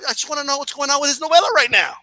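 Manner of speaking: ordinary talk, nothing marked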